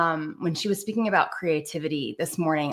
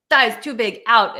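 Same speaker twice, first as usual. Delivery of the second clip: louder, curt mock Russian accent; terrible Russian accent; 4 semitones higher, 6 dB louder, slower than usual. Ballet mistress dismissing a dancer, loud mock Russian accent. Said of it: "that's a terrible Russian accent".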